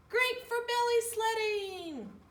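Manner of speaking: high, loud gleeful shout; excited cry. Acted. Tacky thrilled at the hill, high excited shout. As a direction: cry out with delight. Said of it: "he cried".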